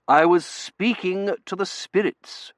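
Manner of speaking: high, slow, prim reply; stiffly. A narrator's reading. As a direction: say it with starched dignity. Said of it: "she said stiffly".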